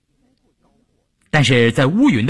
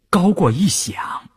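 ordinary delivery, nothing marked

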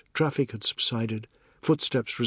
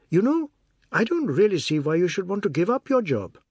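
a hint of a brisk, higher newspaper editor, speeding up; lightly voiced editor; 6 semitones higher, 3 dB louder than usual. A narrator's reading. Editor quizzing Mr Biswas about his age, lightly voiced. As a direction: editor sizing up the applicant, a little quicker.

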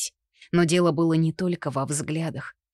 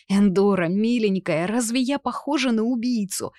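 raised, desperate plea; desperate cry; 5 semitones higher than usual. A narrator's reading. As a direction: cry out in despair. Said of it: "отчаянно закричала Овидия".

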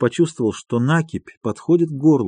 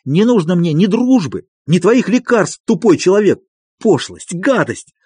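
raised-pitch angry tirade; angry shouting; 6 semitones higher than usual. A narrator's reading. Doctor rejecting friendship and medicine, angry shouting. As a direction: spit out rejection in a heated outburst. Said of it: "продолжал кричать Андрей Ефимович".

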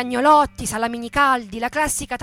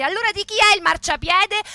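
raised, higher demanding question; captain's challenge; 7 semitones higher, 4 dB louder than usual. Acted. Carabinieri captain demanding an answer of the worker. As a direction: challenge him like an officer.

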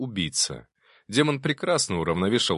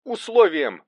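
high, broken-up shout mid-fight; shouted between exertions; 10 semitones higher, 4 dB louder than usual. A narrator's reading. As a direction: cry it out in slow, high bursts. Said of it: "выкрикнул Арсений".

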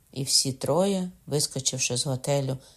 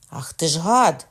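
louder, sharp furious exclamation; angry cry; 3 semitones higher, 7 dB louder than usual. A narrator's reading. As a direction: curse the attacker. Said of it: "скрикнув Кокона".

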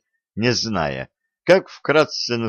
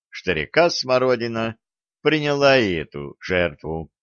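ordinary delivery

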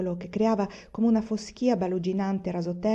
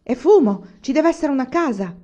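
shouting; 7 semitones higher, 8 dB louder than usual. Acted. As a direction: call out the discovery at full voice. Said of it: "Dopo qualche minuto gridò". (grido forte e lento, voce più alta)

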